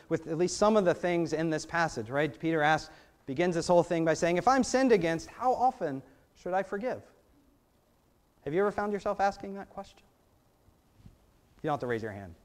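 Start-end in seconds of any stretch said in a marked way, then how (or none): none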